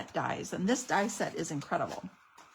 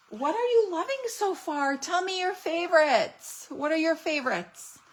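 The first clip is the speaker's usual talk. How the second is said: eager question; 7 semitones higher, 4 dB louder than usual. Real, not acted. Asking viewers eagerly.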